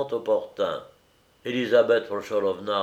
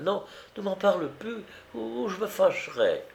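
high-pitched, faltering threat; flustered warning; 11 semitones higher than usual. A narrator's reading. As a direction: warn the maid off, without force. Madame threatens to get angry.

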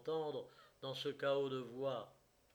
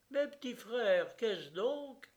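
high-pitched appeal; dismayed cry; 9 semitones higher, 4 dB louder, slower than usual. A narrator's reading. Someone in the crowd appeals to his brothers, crying out high. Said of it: "cria quelqu'un dans la foule".

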